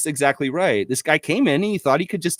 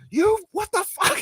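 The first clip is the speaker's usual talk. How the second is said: much higher put-on voice, shrieking "what the fuck"; mock furious Masai. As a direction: ham up a furious exec losing it. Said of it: "Masai was like".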